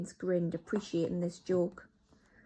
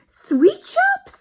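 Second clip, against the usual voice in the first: far higher, much louder astonished squeal; crying out astonished; 16 dB louder than usual. Acted. Witches react to the plan, high astonished squeal. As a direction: disbelief. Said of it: "they cried".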